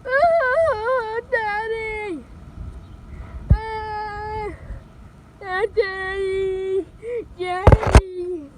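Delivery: loud, drawn-out frightened wail; crying for daddy. Acted. Frightened child cries for father.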